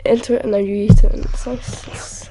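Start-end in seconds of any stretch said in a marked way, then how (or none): 1.1-2.3 whisper. Real, not acted. Whispered aside about crumpets.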